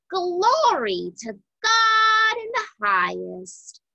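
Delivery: high, ringing angelic proclamation; exultant praise. A narrator's reading. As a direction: proclaim it joyfully, pitched well up. Angels' praise voiced in story.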